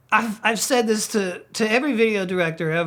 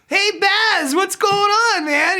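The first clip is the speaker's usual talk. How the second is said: high, louder breezy greeting; mock a cheery rocker pal, pitched way up; imitating Wayne; 13 semitones higher, 4 dB louder, slower than usual. Director Wayne greeting Sebastian backstage, high and loud.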